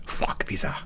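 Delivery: whispered aside. Real, not acted. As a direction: hiss it under your breath. Aside dismissing the visa.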